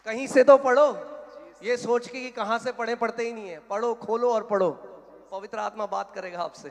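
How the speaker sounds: loud, high-pitched urging from the pulpit; forceful preaching exhortation. Real, not acted. Exhorts congregation at full force. (ज़ोर से ऊँची आवाज़ में उपदेश)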